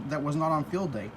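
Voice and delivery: normal talking voice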